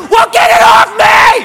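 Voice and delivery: high, loud yell of mock panic; panicked shout. Man begging girlfriend to remove unknown thing, panicked shout. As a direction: scream for help, hammed up.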